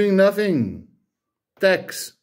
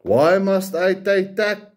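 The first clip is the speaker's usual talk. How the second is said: normal talking voice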